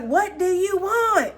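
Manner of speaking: high, slow, hammed-up pleading; Notebook movie hero. Vlogger reenacts The Notebook hero pleading, high and slow. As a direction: overact the desperate lover demanding an answer. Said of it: "The Notebook where he's like".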